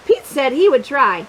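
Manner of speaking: usual speaking voice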